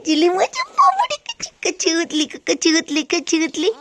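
put-on high, lilting baby talk; mother teasing her just-woken baby in high baby talk; baby-talk cooing. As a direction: coo lovingly at the child.

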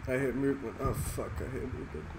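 quiet. Real, not acted. Quiet callouts while hiding.

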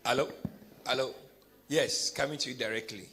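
usual speaking voice

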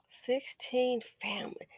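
hushed whispered aside. Acted. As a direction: whisper it like a secret. Dramatic aside.

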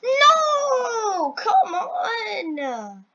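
long, high wail; frustrated wail. Real, not acted. Player who really wants the badge, frustrated wail. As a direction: drag each word out in despair. Fails again at the obby.

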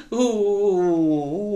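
loud, high, drawn-out "oo" sobbing; bawling wail. A narrator's reading. Girl sobbing over the apron for dad, loud wail. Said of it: "по-справжньому заридала".

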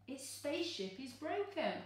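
ordinary delivery

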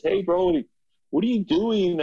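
high, bright bro greeting; friend's voice. Retold or imitated. Friend on a hike asking, high mock bro voice. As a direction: imitate the buddy asking casually. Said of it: "He goes".

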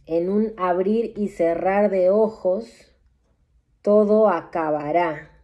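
slow, grave oracle pronouncing the end of days; solemn prophecy read with long pauses; doom-laden prophecy. Acted. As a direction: intone each line like a solemn prophet. Reads apocalyptic prophecy aloud.

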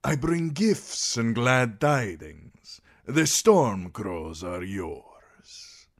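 slow, showy and grand; flamboyant sellsword. A narrator's reading. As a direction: lay on the charm, drawn out. Voices Daario, a flamboyant sellsword.

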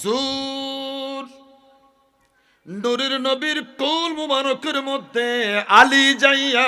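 loud proclamation rising over the crowd; impassioned preaching. Acted. Preacher telling of baby Ali in the Prophet's lap, impassioned.

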